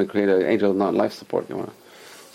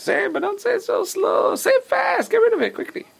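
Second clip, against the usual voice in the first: very high, squeaky tempter voice; voice of temptation. Acted. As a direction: urge them in a tiny falsetto. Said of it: "next thing the Yetzer Hara is".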